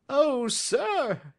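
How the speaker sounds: very high, quivering with eagerness; emotional cry. A narrator's reading. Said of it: "he cried".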